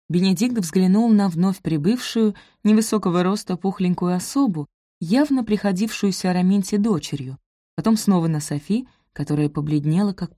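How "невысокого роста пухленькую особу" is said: ordinary delivery, nothing marked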